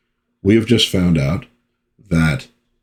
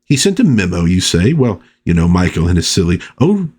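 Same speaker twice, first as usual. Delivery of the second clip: Sybil Fawlty impression; 2 semitones higher than usual. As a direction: play the chatty hotel wife cooing down the line. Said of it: "You could really picture the lines coming out of Prunella Scales".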